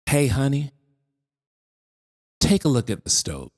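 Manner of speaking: gently steer her, with pauses; ever so gently. Acted. Mother answers her upset daughter, ever so gently. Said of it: "ever so gently".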